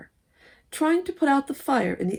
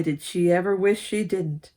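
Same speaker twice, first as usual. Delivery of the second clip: plain reading voice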